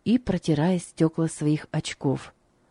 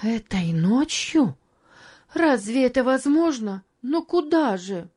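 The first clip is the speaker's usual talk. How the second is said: higher, incredulous woman's questions; taken aback, pleading higher; dismayed wife; 6 semitones higher than usual. Acted. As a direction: protest in a raised pitch. Narrator voices the alarmed wife.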